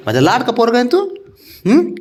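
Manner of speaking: high sing-song coaxing. Real, not acted. Father coaxing his sulking pet, high coaxing voice. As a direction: sweet-talk a sulking little one, voice up high.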